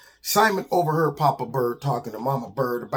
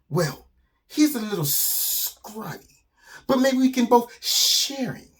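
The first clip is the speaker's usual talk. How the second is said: slow, high sibilant snake; hissing snake; 8 semitones higher, slower than usual. Acted. Snake proposes sharing prey with lizard, hissing. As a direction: draw out the S sounds slyly. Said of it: "And the snake says".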